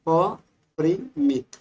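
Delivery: very slow, drawn out अपरिमित for writing down; slow dictation. Shown as a demonstration. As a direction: stretch अपरिमित syllable by syllable as you dictate. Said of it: "और दूसरा अपरिमित".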